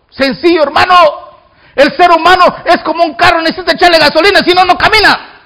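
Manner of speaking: high-pitched, emphatic preacher; forceful preaching. Real, not acted. Preacher explaining people need fuel like cars, emphatic preaching. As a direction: drive the comparison home.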